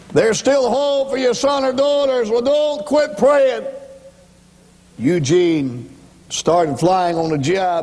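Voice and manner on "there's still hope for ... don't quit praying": high, drawn-out preacher's cry; urgent pulpit appeal, pitched way up; impassioned exhortation. Real, not acted. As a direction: plead with them, voice lifted high and sustained.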